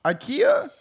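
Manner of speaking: mock dramatic ikea. Dramatic stretched name.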